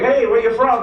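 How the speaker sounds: hammed-up cheery asking; mock friendly question. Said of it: "I was like".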